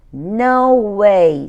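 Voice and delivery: a loud, flat no from a bored brother; loud refusal. Acted. Brother turning down his sister, loud refusal. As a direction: toss it out loudly, no interest.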